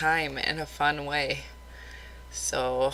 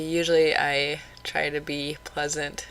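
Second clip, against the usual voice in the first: normal talking voice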